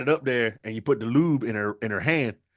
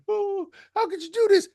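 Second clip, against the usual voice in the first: much higher anguished whine; wailing dad. Acted. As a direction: wail it as a betrayed father. Dying dad laments.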